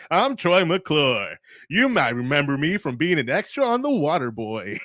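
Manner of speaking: hammed-up rich snob voice; Troy McClure. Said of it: "Oh, the Rad Troy McClure voice".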